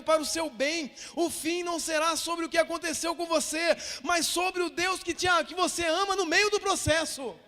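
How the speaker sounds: loud, impassioned pulpit delivery; full-force preaching. Real, not acted. Pastor urging the congregation about family and God, impassioned preaching. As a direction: drive each phrase home to the congregation.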